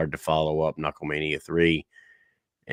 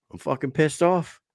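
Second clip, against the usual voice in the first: retold angry champ; 8 semitones higher than usual. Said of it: "He goes".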